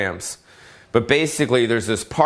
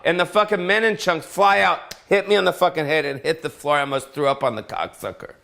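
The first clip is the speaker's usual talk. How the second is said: normal talking voice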